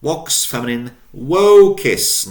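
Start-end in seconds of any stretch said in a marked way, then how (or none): 1.2-2.3 vocis with a long, stretched O. Shown as a demonstration. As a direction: draw out the O in vocis. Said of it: "Notice the elongation of the O".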